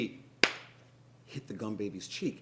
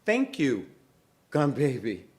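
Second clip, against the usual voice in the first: higher, louder thank you, insisting; pointed repeat; 4 semitones higher, 8 dB louder than usual. Acted. Moesha repeating her thanks, pointedly. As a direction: say it again so he hears. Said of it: "Perhaps you didn't hear me. I said".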